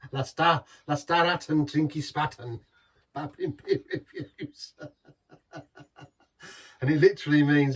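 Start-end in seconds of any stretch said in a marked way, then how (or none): 0.1-2.6 hammed-up bad German accent, a bit louder; a joke German accent, leaning into it; terrible German accent. Beer vlogger hams up a German slogan in a bad German accent. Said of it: "Terrible German accent there". 3.1-4.7 very high, slow, laughing mock German accent. Vlogger signs off in a high laughing mock German accent. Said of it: "Terrible German accent there".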